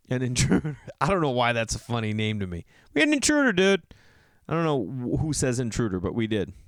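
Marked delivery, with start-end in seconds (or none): 2.9-3.8 high, loud bro voice; mock bro brag. Mocking a guy bragging to his friends about an intruder. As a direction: brag like a dude telling his buddies.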